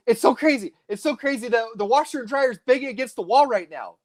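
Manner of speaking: a touch of a frazzled caller; imitating caller. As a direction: lightly play a rattled woman. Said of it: "so she goes".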